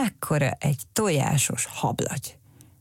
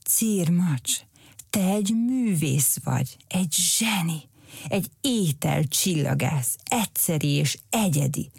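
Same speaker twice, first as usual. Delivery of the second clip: piling on praise; 3 semitones higher than usual. A narrator's reading. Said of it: "próbálta fokozni a jelzőket".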